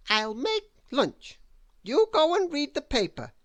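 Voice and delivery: high, quick bossy orders. A narrator's reading. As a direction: shoo him off, high and brisk. Miss Bossy voiced in story.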